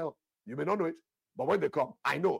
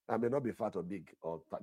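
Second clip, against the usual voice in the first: ordinary delivery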